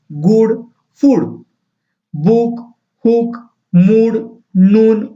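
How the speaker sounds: each word said slowly and clearly, with a pause after it; slow word list. Shown as a demonstration. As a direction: read them out one by one, like a teacher. Reads a phonics word list. (धीमे-धीमे साफ़ शब्द पढ़ना)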